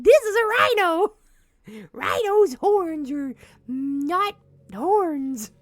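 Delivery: high loud goofy narrator; cartoon lizard voice. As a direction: ham up an edutainment cartoon. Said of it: "be like".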